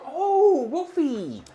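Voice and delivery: very high, loud delighted squeal; real burst of high-pitched excitement; excited greeting. Real, not acted. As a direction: greet a friend with a big, laughing shriek. Spots Wolfie arriving in chat.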